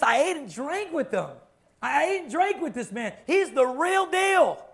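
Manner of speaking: high, loud, emphatic witness, slowing at the end; excited Peter. Retold or imitated. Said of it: "And so when Peter says".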